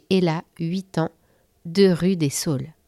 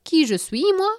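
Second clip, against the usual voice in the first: higher, affronted who-me; offended retort; 10 semitones higher, 3 dB louder than usual. Acted. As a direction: bristle at the question. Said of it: "s'offusqua l'animal".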